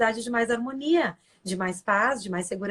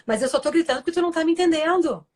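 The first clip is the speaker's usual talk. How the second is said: defensive retort; 6 semitones higher, 5 dB louder than usual. Retold or imitated. Other person snapping back defensively.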